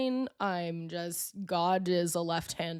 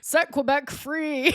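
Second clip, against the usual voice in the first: put-on drunk mic call; 6 semitones higher, slower than usual. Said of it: "got up on the mic and said".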